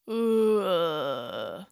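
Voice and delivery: low moan in a monster voice. Acted. Frankenstein moaning in reply to Boney, low groan. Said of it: "Frankenstein moaned in response".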